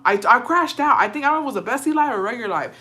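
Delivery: ordinary delivery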